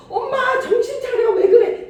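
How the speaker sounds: high, loud, frantic calling to a dying mother; panicked child at bedside. Retold or imitated. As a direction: plead in alarm with a laughing edge. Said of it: "이게 아니에요".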